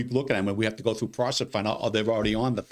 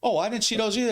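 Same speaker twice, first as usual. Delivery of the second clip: mock clueless voter; 11 semitones higher than usual. Voter voiced in retold exchange.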